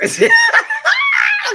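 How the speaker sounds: loud high screaming cackle; screaming laugh. Real, not acted. Retelling the club story, screaming laugh. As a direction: howl with laughter.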